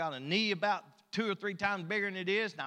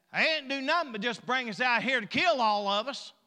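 loud, high mock griping; whining complaint; 5 semitones higher, 6 dB louder than usual. Preacher voices the people in the wilderness, whining complaint. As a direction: imitate a crowd grumbling at full volume. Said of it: "murmur and complain and whine".

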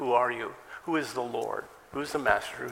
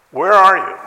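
much louder, higher "Where are you?"; shouted question; 7 semitones higher, 17 dB louder than usual. Shown as a demonstration. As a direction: hurl the question at the room. Preacher repeats the question emphatically.

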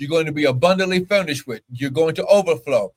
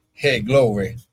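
normal talking voice

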